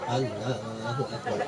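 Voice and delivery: very soft, low, slow Allahu Akbar; limp, spiritless. Shown as a demonstration. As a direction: mumble it with no spirit at all. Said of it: "ndak semangat gitu".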